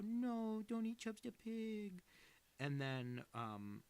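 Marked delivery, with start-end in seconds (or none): 0.0-2.0 high, slow wailing plea; pleading little brother. Retold or imitated. Little brother begging his sister to spare the pig, high slow whine. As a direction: beg like a small kid losing his pet. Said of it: "And he would go".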